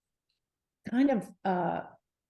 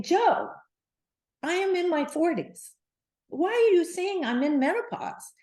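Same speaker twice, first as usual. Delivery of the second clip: much higher, indignant protest; retold anger; 9 semitones higher than usual. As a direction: pitch up high and protest like you're offended. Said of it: "I looked at him with such anger".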